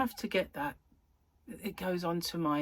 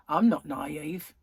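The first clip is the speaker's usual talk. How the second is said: put-on indignant child; 2 semitones lower, 5 dB louder, slower than usual. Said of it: "And I went".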